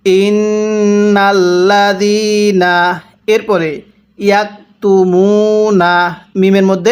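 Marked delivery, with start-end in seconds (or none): 0.0-3.0 slow, drawn-out tajweed chanting; slow Quran recitation. Shown as a demonstration. Teacher demonstrating recitation to students, slow chant. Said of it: "কিভাবে পড়ব?". 4.2-6.1 slow, drawn-out tajweed chanting; slow Quran recitation. Shown as a demonstration. Teacher demonstrating recitation to students, slow chant. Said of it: "এক আলিফ টেনে পড়বেন".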